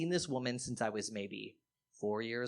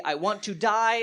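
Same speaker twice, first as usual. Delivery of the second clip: hammed-up cry of despair, louder; mock dramatic declaration; 6 semitones higher, 6 dB louder than usual. Comic voicing the drunk husband's dramatic death wish. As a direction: overact it like a soap villain. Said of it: "he declares dramatically".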